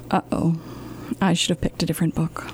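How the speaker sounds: fast whispered regret; whispered aside. Acted. Librarian confides to the kids, fast whisper.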